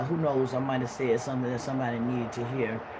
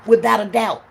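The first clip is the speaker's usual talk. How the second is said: loud, higher confident shout; preaching at full force; 7 semitones higher, 8 dB louder than usual. Acted. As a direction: preach it to the back row.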